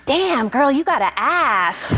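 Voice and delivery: high, drawn-out admiring exclamation. Retold or imitated. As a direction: imitate an impressed star, stretching the last word. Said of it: "[name] looks up and goes".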